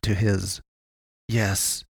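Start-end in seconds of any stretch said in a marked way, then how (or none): 1.3-1.8 softly pronounced one-word answer. A narrator's reading. Wounded man answering the boy, pronouncing it. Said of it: "he pronounced".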